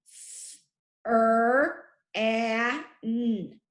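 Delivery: "f-, er, a, n" sound by sound, with gaps. Shown as a demonstration. Teacher builds a syllable for first graders, sounding out each letter. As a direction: sound out "f-, er, a, n" one letter sound at a time. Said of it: "The second syllable is".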